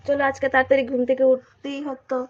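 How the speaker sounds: ordinary talk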